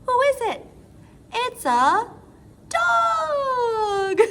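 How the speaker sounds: put-on high, loud reveal to kids; excited. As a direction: build it up, then announce. Guessing game with children.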